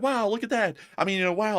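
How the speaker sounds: amazed alien onlooker. Acted. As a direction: marvel like a visitor seeing curiosities. Imagined aliens gawking at humans.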